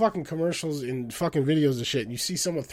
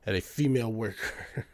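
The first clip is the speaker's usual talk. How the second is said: laughing; 3 semitones lower, 7 dB quieter than usual. Real, not acted. Laughs while reading news.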